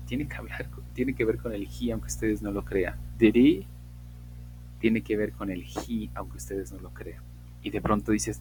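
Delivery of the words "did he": "Did he" fused into "diri", h dropped; reduced "Did he", louder, no h; fast-speech "Did he". Shown as a demonstration. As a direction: slur "Did he" into one word. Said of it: "algunos dirán".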